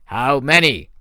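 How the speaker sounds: loud, demanding general. Acted. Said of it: "the general demanded".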